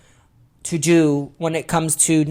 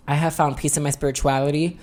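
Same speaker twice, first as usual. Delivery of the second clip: ordinary talk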